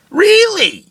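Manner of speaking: high scornful "Really?"; sarcastic disbelief. Real, not acted. Mocking the young executives, a high scornful "Really?". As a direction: sneer it with mock surprise.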